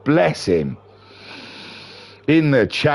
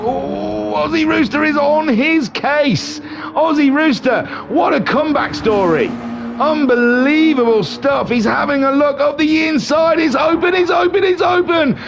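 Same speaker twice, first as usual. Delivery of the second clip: excited race call; 13 semitones higher than usual. Real, not acted. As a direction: yell it as the cars make contact. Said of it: "My voice hurts".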